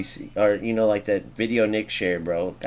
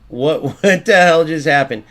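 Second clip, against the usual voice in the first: louder, higher, incredulous laugh in the words; baffled disbelief; 4 semitones higher, 7 dB louder than usual. Real, not acted. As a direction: react stunned to what you just saw. Glitch happens in the game.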